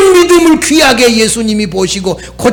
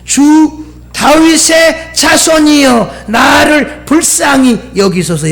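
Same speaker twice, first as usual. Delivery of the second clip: drawn-out, raised plea for mercy; preacher's retold cry for mercy; crying out to the Lord; 6 semitones higher, slower than usual. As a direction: call out slowly, pleading for pity. Reciting a woman's cry to Jesus.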